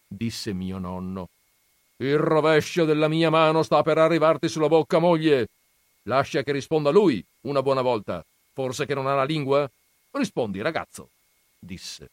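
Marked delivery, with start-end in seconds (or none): 2.0-5.5 much louder, raised, quick threat; gruff threat. Acted. Grandfather threatens wife. 6.0-11.1 lightly voiced scornful old grandfather, raised; gruff grandfather.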